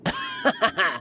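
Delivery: a high, mocking laugh at her expense. Villain laughing off the girl's threat, high and mocking.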